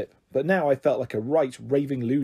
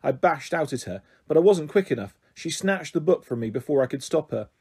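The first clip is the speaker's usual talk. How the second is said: usual speaking voice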